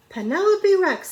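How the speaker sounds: high, loud call of her name; teacher calling out. A narrator's reading. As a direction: call her name sharply across the class. Teacher calls out to Penelope.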